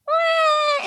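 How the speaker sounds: mock baby wail; quick playful baby cry. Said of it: "It's like a baby's".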